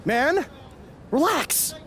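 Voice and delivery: high, urgent protest; alarmed backpedal. Acted. Wrestler whose joke went wrong, high alarmed backpedal. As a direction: plead it was only kidding, voice shooting up. Backpedals after rival takes him seriously.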